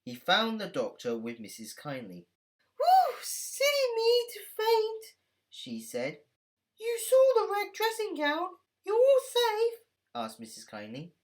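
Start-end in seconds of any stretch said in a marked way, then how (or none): 2.8-5.2 very high, slow frail lady voice; elderly lady recovering from a faint; old lady voice. A narrator's reading. Old lady speaks after fainting. 6.8-9.8 put-on elderly lady recovering from a faint; old lady voice. A narrator's reading. Missus Kindly asks anxiously.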